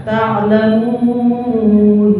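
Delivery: "Ta'lamun" chanted with a long held u; four-count madd. Shown as a demonstration. Teacher showing the middle length, chanted four-beat madd. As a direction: hold the u of "Ta'lamun" four beats. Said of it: "Empat harakat".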